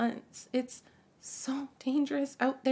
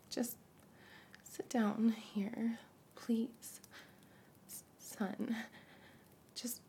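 coaxing whisper; 5 dB quieter than usual. Acted. Mother quietly coaxing her son, whispered. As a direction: hush and coax him.

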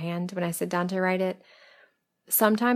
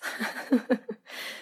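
breathy laugh; 6 semitones higher, 4 dB quieter than usual. Real, not acted. Laughs at her own joke.

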